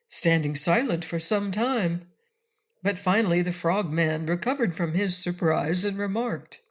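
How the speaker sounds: usual speaking voice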